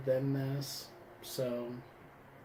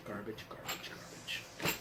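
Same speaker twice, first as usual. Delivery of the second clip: much softer, sinking into a whisper; fading mutter; 8 semitones lower, 17 dB quieter than usual. Real, not acted. Dismissing the goggles, trailing off into a whisper. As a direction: let the word die away under your breath.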